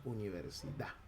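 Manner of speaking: "universidad" ending "universida", final d silent; dropped final d. Shown as a demonstration. Profe muestra la elisión final de la d ante la clase.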